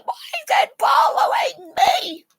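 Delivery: put-on high laughing shriek of a frightened kid; panicked squeal. Retelling the squirrel chase at Science North, shrieking with laughter. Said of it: "hiding behind other people ... going".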